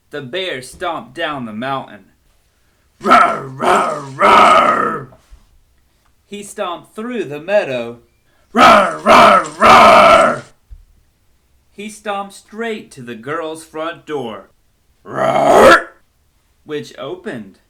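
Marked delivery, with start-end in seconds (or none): 3.0-5.1 loud growling roar; bear roar. Acted. Bear stomping and roaring. 8.5-10.4 loud growling roar; bear roar. Acted. Bear stomping and roaring. 15.0-15.9 loud growling roar; bear roar. Acted. Bear roars at the door.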